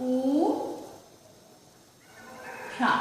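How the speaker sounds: উ- সা split into two beats; উষা ভেঙে ভেঙে উচ্চারণ; syllable by syllable. Shown as a demonstration. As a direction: sound out উষা as u, then sha. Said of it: "কি লিখতে হবে উচ্চারণে".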